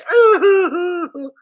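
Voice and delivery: sudden high loud laugh of delight; burst of laughter. Real, not acted. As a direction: laugh out, overjoyed. Ready to cry" with joy.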